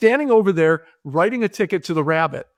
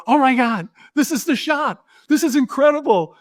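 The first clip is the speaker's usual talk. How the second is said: much higher, gushing over the shot; crazy excited director; 8 semitones higher than usual. Pretend. Director gushing at the cop, hammed-up high excitement. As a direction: play the wildly thrilled director, voice soaring. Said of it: "play the part of that crazy, excited director".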